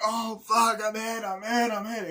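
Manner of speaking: high, loud panicked cry, imitating; wounded soldier yell. Retelling the wounded soldier in the film, loud mock panic. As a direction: scream like you're hit, fading out. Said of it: "it's like".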